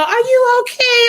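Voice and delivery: high, slow mock concern; exaggerated high-pitched worry; concerned stranger. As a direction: draw it out high, imitating a worried lady.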